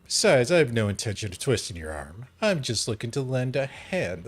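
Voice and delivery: normal talking voice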